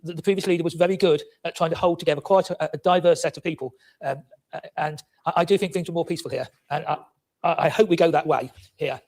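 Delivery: normal talking voice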